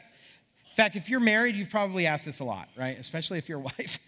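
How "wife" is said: ordinary talk, nothing marked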